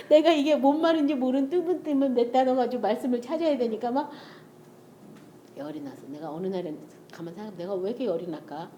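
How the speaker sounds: ordinary talk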